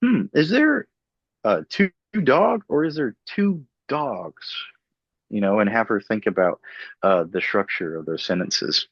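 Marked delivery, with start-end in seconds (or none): 0.0-4.5 much higher, sing-song teacher voice; playful clinician question. Retold or imitated. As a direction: ask the child like a curious game host. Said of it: "It'd be like".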